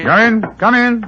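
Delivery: high, loud call to the door. Acted. Holmes calling to a knock at the door, loud call. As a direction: call them in from across the room.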